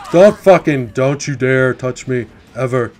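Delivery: loud slowed threat; angry yell. Real, not acted. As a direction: shout it to keep her away. Killer attacking his character.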